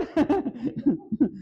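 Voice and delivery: laughing at his own joke; real laughter; hearty laugh. Real, not acted. Laughter continues.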